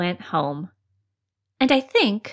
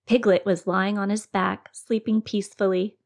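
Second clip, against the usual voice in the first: ordinary delivery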